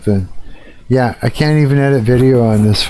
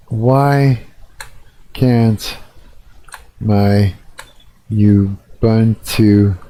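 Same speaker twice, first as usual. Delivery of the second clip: slow word-by-word dictation; 2 semitones lower, much slower than usual. Real, not acted. Streamer dictating his question to the AI, slow word by word. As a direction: spell it out one word at a time as you type.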